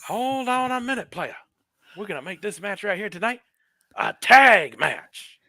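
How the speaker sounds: high put-on Teddy Long voice, booming on the tag match; Teddy Long impression. Friend retelling a wrestling clip, Teddy Long voice. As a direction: ham up the GM's catchphrase, high and loud. Imitating Teddy Long's catchphrase.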